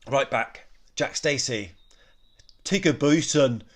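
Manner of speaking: jokey Scottish accent, as a put-on commentator; Andy Gray Scottish accent. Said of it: "That's my Scottish accent, terrible. Andy Gray, eat your heart out".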